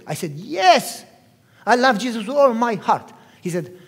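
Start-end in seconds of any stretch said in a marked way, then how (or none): 0.3-3.1 loud, raised, insistent avowal; acted-out earnest protest; emphatic declaration. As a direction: declare it forcefully to a doubter. Son insists to doubting father.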